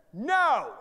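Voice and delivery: booming high shout; God yelling. Acted. God stopping a man on his front step, yelling. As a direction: thunder out a sudden command. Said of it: "God is yelling at me".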